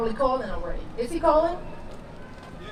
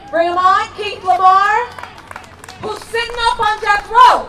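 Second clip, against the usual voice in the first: loud, rousing announcement to the crowd; calling out loud; 4 semitones higher, 8 dB louder than usual. Real, not acted. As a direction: shout his name out to the whole rally. Announcing caller to rally crowd.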